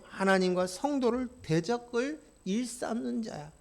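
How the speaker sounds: ordinary delivery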